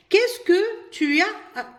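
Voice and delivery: very slow, clear French question; slow model question. Shown as a demonstration. Teacher asks pupils the example question, slowly. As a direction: ask it slowly so pupils catch every word. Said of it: "نطرح السؤال باش ننفي".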